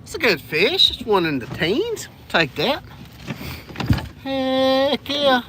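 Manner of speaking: ordinary talk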